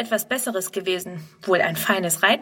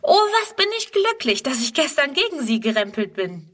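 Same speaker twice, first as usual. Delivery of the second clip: overjoyed; 5 semitones higher than usual. Acted. Girl overjoyed about the horse.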